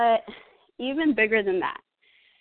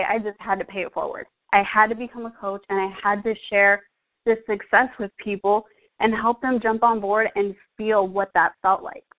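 ordinary talk

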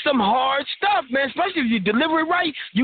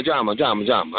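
drama, drama dropping far lower each time; trailing mock drama; 13 semitones lower than usual. Mocking the lady drama at home, sinking lower. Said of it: "lady drama".

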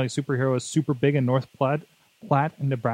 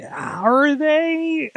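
sarcastic; 12 semitones higher, 3 dB louder than usual. Acted. Sceptical mock question.

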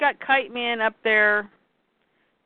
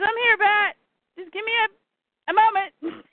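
much higher, playful Catwoman; 12 semitones higher than usual. Retold or imitated. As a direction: purr it up high like Catwoman. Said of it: "she goes".

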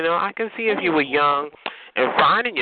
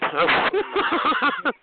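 laughing; 8 semitones higher than usual. Real, not acted. Laughs at caller's voice.

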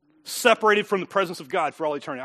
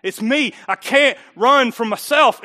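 ordinary talk

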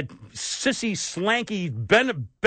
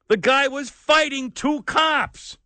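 usual speaking voice

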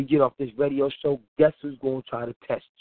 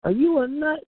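higher, incredulous jab; incredulous inner voice; 11 semitones higher than usual. Retold or imitated. Host's inner voice scolding him for quitting, high incredulous. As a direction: scoff at yourself in disbelief. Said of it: "something in me like".

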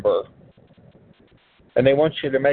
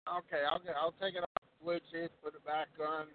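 faint Bluetooth phone audio; distant, tinny call; phone speaker; 3 semitones higher, 17 dB quieter than usual. Real, not acted. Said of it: "You sound like you're on the other side of the earth".

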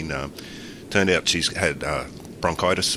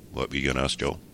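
ordinary talk

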